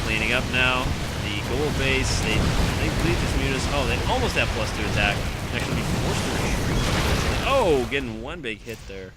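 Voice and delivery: loud, driving esports commentary; live play-by-play. Real, not acted. Caster narrating the battle to viewers, loud driving call. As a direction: keep the energy up through the fight.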